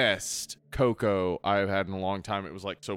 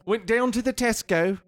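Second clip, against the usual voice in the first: much higher, hammed-up British voice; mock British accent; 10 semitones higher than usual. Boasting their accent beats Roger's, high mock British. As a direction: put on a silly cockney lilt, high. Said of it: "Roger's accent's not as good as ours".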